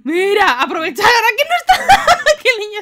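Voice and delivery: loud, high words broken by laughter. Real, not acted. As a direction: shout it out through the giggles. (gritando entre risas)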